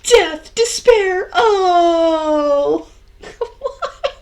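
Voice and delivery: high, loud melodramatic wail; hammed-up sobbing cry of doom; screaming despair. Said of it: "Is that your client screaming over there?".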